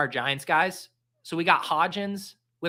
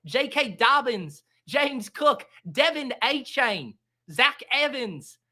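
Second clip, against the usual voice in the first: hammed-up Australian accent; 8 semitones higher than usual. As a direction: ham up an Australian announcer. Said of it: "I have to anchor myself with this accent".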